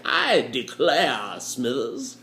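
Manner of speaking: slow, drawn-out Southern gentleman drawl; lazy Southern aristocrat declaring; southern accent. Pretend. Elite gentleman in a drawing room, Southern drawl. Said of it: "I don't know why you have a southern accent".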